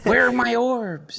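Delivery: put-on slow, high whine of a kid who wants his orbs; mock whiny kid. Host teasing guest, whining slowly like an impatient kid. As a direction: drag it out like a sulking teenager.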